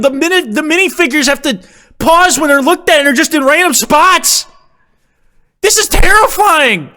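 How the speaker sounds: high-pitched stunned shriek; shocked screaming. Real, not acted. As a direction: squeal in disbelief. Shocked by the figure army.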